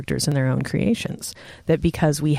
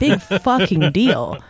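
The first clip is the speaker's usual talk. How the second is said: scornful; 4 semitones higher, 4 dB louder, slower than usual. Real, not acted. Scornful dismissal.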